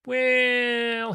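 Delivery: Skinny drawling before his offer. Acted. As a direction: stretch the word lazily. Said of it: "he drawls".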